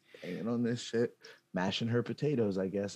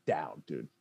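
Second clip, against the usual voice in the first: ordinary talk, nothing marked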